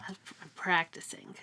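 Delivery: a quick whispered aside; a playful whispered confession. Real, not acted. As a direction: drop to a whisper, half joking. Jokes about rehearsing a library spiel.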